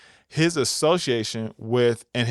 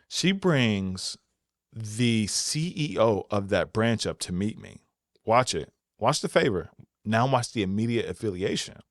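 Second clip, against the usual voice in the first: ordinary delivery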